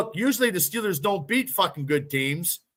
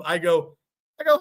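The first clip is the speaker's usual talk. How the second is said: ordinary talk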